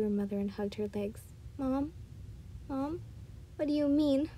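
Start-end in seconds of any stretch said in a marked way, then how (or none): 1.6-4.3 higher, small questioning voice; anxious little girl. A narrator's reading. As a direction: call for Mom softly, like a worried child.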